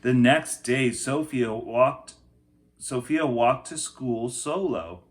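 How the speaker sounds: put-on lively read-aloud for children; picture book reading. A narrator's reading. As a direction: tell the story to a class.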